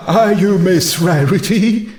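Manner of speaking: lightly voiced timid ghost; a hint of a gentle ghostly mare. Narrator voicing the shy ghost asking for Rarity, lightly voiced.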